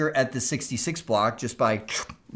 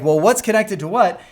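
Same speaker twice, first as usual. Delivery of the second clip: higher, louder puzzled question; mock confused staff; 5 semitones higher, 5 dB louder than usual. Confused school staff asking what connects where, higher. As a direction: act out baffled school staff. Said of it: "folks are like".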